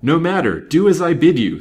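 high, loud impatient command; booming, impatient detective; thundering impatience. A narrator's reading. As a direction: thunder the order at a stubborn servant. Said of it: "thundered the impatient detective".

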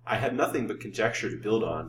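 ordinary delivery

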